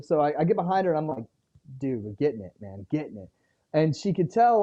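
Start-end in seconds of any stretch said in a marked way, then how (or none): 1.8-3.3 pep sinking lower and softer with each word; fading enthusiasm. Retold or imitated. Dad distracted by his phone while pushing the swing, trailing off flat. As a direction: cheer her on half-heartedly, running out of steam. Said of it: "enthusiasm starts going down".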